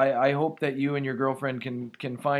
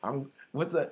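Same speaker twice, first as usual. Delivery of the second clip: ordinary delivery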